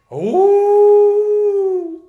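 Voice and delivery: high loud howl on oh; wolf howl. Pretend. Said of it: "I have to enact a wolf".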